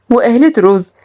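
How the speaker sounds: ordinary delivery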